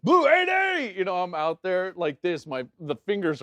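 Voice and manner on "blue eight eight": loud, very high snap-count holler; re-enacted shout to the offense; quarterback's cadence call. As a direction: bark the signals across the line. Quarterback calling snap count, retold.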